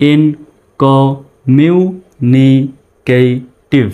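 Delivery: "ইনকমিউনিকেটিভ" drawn out very slowly in separate syllables. Shown as a demonstration. Teacher showing learners how a long word splits, syllable by syllable. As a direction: say each syllable of "ইনকমিউনিকেটিভ" alone, with gaps. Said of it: "ভেঙে ভেঙে উচ্চারণ করার".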